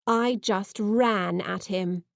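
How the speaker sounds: usual speaking voice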